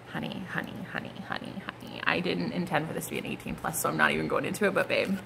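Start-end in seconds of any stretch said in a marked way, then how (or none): 0.1-2.0 whispered, fading teasing honeys. Real, not acted. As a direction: breathe the honeys in a hushed tease.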